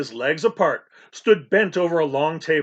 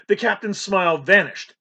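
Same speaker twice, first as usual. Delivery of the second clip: ordinary delivery, nothing marked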